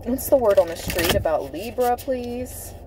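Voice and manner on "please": ordinary delivery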